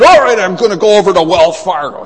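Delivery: loud, fed-up mock outburst; frustrated outburst. Frustrated customer storming off.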